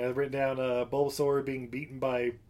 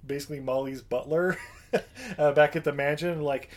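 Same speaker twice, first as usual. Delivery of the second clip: usual speaking voice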